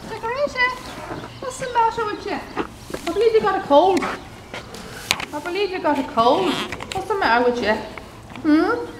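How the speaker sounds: high, sing-song coaxing to a horse; pet talk. Real, not acted. Stud hand to Decoration with a cold: high sing-song coo. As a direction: coo it like talking to a sick pet.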